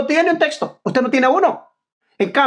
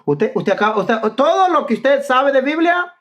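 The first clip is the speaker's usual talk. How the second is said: normal talking voice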